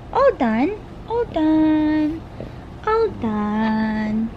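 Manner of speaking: drawn-out, playful singsong. Real, not acted. Mother finishes powdering her baby, singsong baby talk.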